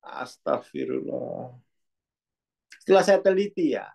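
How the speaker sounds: normal talking voice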